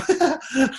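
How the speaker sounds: short high laugh at his own impression; a quick laugh breaking through; amused laugh. Real, not acted.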